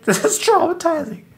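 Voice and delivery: high-pitched laughing wail; real embarrassed laughter, voice shooting up; laughing in embarrassment. Real, not acted. Laughing over own embarrassing memory.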